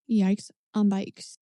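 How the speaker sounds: drawn-out yikes. Acted. Drawn-out catchphrase reaction.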